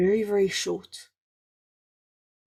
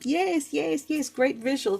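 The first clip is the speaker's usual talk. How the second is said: excited; 5 semitones higher, 3 dB quieter than usual. Real, not acted. Delighted agreement with viewer.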